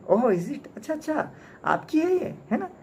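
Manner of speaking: a thief taunting the umbrella owner; mocking sneer. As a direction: fake innocence, dripping with sarcasm. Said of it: "इसने बड़ी तंजिया हंसी हंसा".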